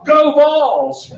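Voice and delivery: high, loud team cheer. Retold or imitated. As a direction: shout it to a fellow fan. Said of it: "And he goes".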